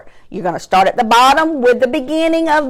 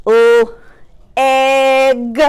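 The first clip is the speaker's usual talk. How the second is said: "U-, egg" as split sounds, each held long; chopped word; 2 semitones higher than usual. Shown as a demonstration. Teacher chopping a word for pupils, sounds split apart. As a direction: chop LEG sound by sound. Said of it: "let's chop".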